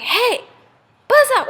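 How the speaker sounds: loud, high cry of protest; indignant shout. Acted. Bees to a hand stealing honey, indignant shout. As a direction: yell at a thief to back off.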